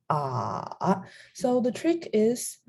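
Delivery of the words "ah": "Ah" dropped low and drawn out; low third tone. Shown as a demonstration. Teacher shows the third tone, low dipping "Ah". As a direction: sink "Ah" down low. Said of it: "is a low tone, so it goes like".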